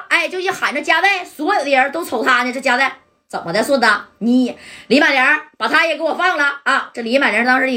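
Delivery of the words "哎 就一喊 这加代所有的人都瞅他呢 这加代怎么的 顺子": plain reading voice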